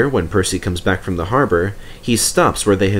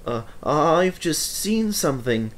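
shaky voice; 6 semitones higher, 5 dB quieter than usual. A narrator's reading. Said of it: "said Toby in a shaky voice".

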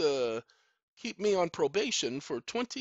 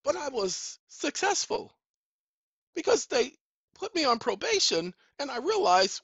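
ordinary talk

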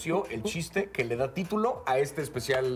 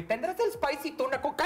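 annoying moviegoer voice; 11 semitones higher than usual. Retold or imitated. Mimics annoying guy in cinema.

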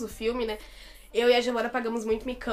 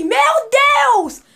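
loud exclamation; 13 semitones higher, 14 dB louder than usual. Shown as a demonstration. Fan showing how he reacted, loud shrieked exclamation. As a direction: demonstrate a very high, loud shriek. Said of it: "Eu fiz assim".

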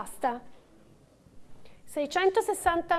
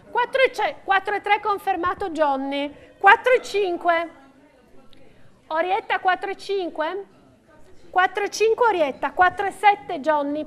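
steady rhythmic bid calling; auctioneer calling bids; 3 semitones higher, 4 dB louder than usual. Real, not acted. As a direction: call out each bid to the room.

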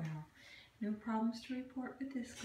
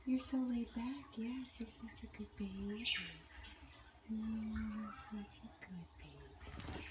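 whisper; 6 dB quieter than usual. Real, not acted. Whispering to a cat.

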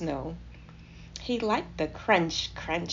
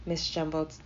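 ordinary delivery, nothing marked

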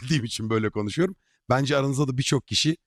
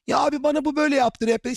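whiny complainer voice; 10 semitones higher than usual. Retold or imitated. Mocking a complaining player.

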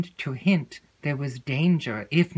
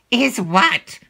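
louder, clipped prompt; impatient; 7 semitones higher, 7 dB louder than usual. Acted. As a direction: hurry her up. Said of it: "said her lady impatiently".

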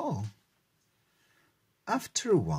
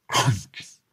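loud crunch sound; 3 semitones lower, 7 dB louder than usual. A narrator's reading. Fox bites the cookie.

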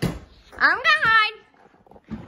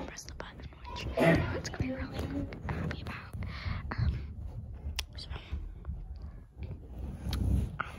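whispering; 4 semitones lower, 9 dB quieter than usual. Real, not acted. Hiding from seeker, whispering.

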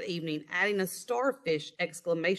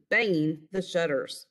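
usual speaking voice

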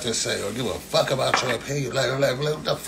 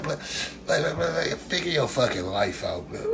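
normal talking voice

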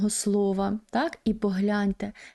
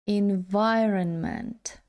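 "Environment" said slowly, stress on the second syllable; slow demonstration; much slower than usual. Shown as a demonstration. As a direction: model "Environment" slowly and carefully. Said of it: "наголос на другому складі". (повільно "Environment", наголос на другому складі)

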